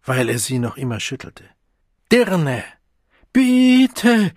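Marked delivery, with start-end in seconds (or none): 2.1-2.6 raised, loud, falling insult; furious one-word accusation; angry shout. Acted. He shouts while shaking her. 3.3-4.3 very high, loud begging; desperate wailing cry; quavering plea. A narrator's reading. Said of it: "ihre Stimme bebte lächerlich".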